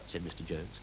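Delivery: ordinary delivery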